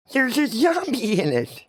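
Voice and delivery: put-on a high kid's voice, dead serious; earnest kid. Johnny tells the bully about the zombie, high and earnest. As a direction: state it like a plain fact.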